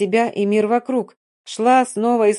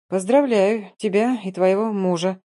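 plain reading voice

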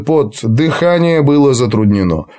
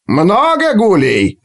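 slow, high gleeful cry; 9 semitones higher, slower than usual. Acted. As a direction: relish the coming fight. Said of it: "радостно поправил Снорри".

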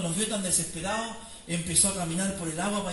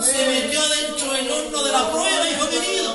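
preaching at full force; 5 semitones higher, 10 dB louder than usual. Acted. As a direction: preach it out to the congregation, almost singing. Preacher at climax of sermon.

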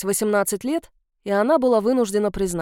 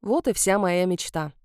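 ordinary delivery, nothing marked

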